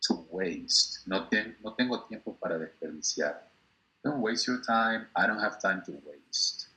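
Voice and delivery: ordinary talk, nothing marked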